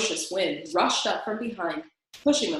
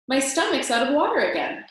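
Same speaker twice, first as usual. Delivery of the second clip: cheerful announcement; 5 semitones higher, 5 dB louder than usual. A narrator's reading. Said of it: "announced the dragon cheerfully".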